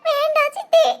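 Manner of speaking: weeping no to the stepmother; crying plea. Acted. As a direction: plead through sobs, rising. Bride begs stepmother, weeping.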